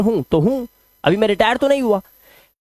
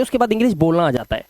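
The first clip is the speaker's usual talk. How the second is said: ordinary talk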